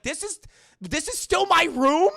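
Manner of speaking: high disbelief building to a shout; amazed teen. Retold or imitated. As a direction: stretch it out, astonished and loud.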